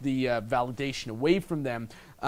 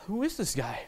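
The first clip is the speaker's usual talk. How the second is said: put-on girl suddenly intrigued; 4 semitones higher, 6 dB quieter than usual. Voicing girl's sudden interest.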